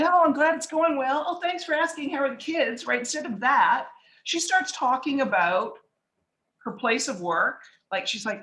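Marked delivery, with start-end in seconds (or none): 0.0-2.9 high, light polite chit-chat; neighborly small talk. Acted. Neighbor making fluff conversation instead of real talk, polite. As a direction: trade pleasantries in a raised, chatty voice. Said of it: "just had the neighborly fluff conversation".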